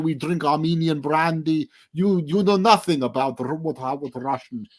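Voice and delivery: a bad Russian accent, lecturing indignantly; awful Russian accent. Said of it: "I'm doing this most awful Russian accent".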